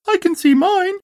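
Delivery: very high-pitched squeaky Roo voice; little Roo crying out. A narrator's reading. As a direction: cry out as an eager little Roo. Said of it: "cried Roo".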